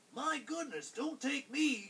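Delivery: high-pitched goat plea; pleading second goat. A narrator's reading. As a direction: beg off as the middle goat. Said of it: "said the second Billy Goat".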